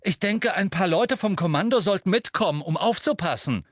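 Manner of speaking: high, keen pupil's suggestion; volunteer advice eagerly to the teacher; eagerly officious. A narrator's reading. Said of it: "sagte Malfoy beflissen".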